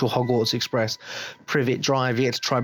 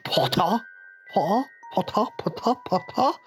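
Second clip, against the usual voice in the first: repeated high taunt, climbing each time; Malfoy; 7 semitones higher than usual. Acted. School rival calling the boy's surname, climbing high taunt. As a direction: say the name over and over, higher and higher. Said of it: "You got Malfoy like".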